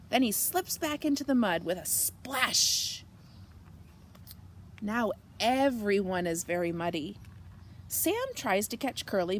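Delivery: ordinary delivery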